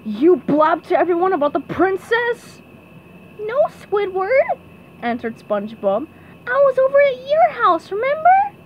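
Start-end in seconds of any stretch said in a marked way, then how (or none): 0.1-2.6 raised, louder, mildly angry voice; angry accusation. A narrator's reading. Angrily turning on SpongeBob for blabbing, accusing. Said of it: "He angrily turned to SpongeBob". 3.4-4.6 high, slow cartoon reply. A narrator's reading. SpongeBob answering Squidward's accusation, high-voiced denial. 6.5-8.6 very high, loud cartoon voice. A narrator's reading. SpongeBob reminding Squidward where he was, high loud protest.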